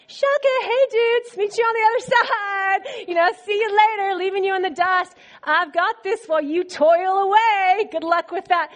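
high, playful dude-style send-off; breezy mock taunt. Preacher imitating Jesus leaving the rowers in the dust, breezy taunt. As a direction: toss off a cocky, teasing goodbye.

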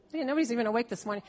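ordinary delivery

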